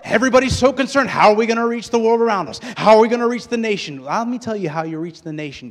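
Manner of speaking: loud, high-pitched pulpit shout; full-force preaching. Real, not acted. Preacher challenging a worried congregation, full-force preaching. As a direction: preach it hard, easing at the end.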